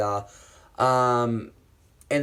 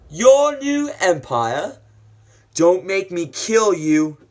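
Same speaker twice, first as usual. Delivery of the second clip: loud, high, drawn-out disbelief; theatrical raised-voice confrontation; acted shocked challenge; 7 semitones higher, 9 dB louder, slower than usual. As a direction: question it aghast, then warn forcefully. Acts out Obi-Wan's shocked challenge.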